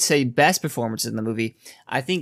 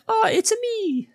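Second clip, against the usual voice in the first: much higher, cartoonish Italian Mario accent. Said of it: "rather than being like".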